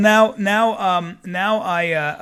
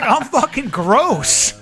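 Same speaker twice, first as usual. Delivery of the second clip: mock disgust; 7 semitones higher, 6 dB louder, much slower than usual. Self-disgust punchline, drawn out.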